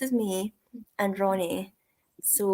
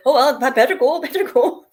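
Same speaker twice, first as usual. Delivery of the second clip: hurried exit; 4 semitones higher, 8 dB louder than usual. Retold or imitated. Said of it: "physically backing away from me".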